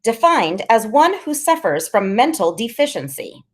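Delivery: high-voiced kid spelling it out slowly, then defining; Freak reciting a definition. A narrator's reading. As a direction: pitch it high like a know-it-all reciting. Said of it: "Freak says".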